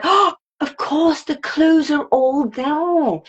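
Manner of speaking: high, slow penny-drop; dawning realisation. Retold or imitated. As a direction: draw it out high as it clicks. Realising clues on rereading.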